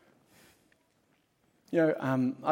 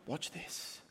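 hushed, breathy whisper with no voice; whispered aside; 16 dB quieter than usual. Pretend. Preacher voicing a smug Jesus, whispering. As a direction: lean in and whisper it like a secret trick. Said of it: "Or will he go and go".

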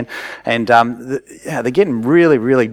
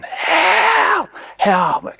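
retold scream for help. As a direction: call for help as if buried in sand. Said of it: "he is screaming".

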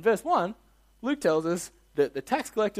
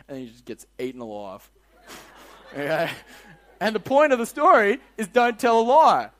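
ordinary delivery

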